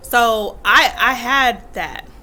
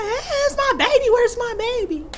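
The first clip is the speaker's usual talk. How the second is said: put-on much higher, sing-song baby talk; doting grandpa; 12 semitones higher than usual. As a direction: coo like a grandpa adoring a baby. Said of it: "He's like".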